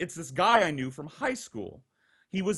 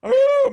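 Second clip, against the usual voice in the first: loud mock party "ooh"; imitated drunken whoop; 11 dB louder than usual. Roommates stumbling in at night, imitated loud whoop. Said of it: "making all kinds of noise".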